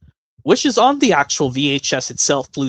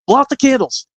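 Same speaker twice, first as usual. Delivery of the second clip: excited host; 10 semitones higher, 5 dB louder than usual. Retold or imitated. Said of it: "do an impression from a Blue's Clues episode".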